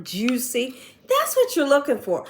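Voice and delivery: ordinary delivery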